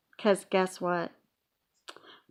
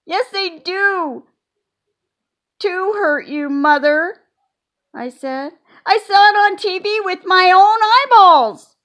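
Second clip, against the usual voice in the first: put-on arguing child; 10 semitones higher, 9 dB louder than usual. A narrator's reading. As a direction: dig in and argue. Junie B arguing with Mother.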